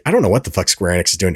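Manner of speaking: ordinary talk, nothing marked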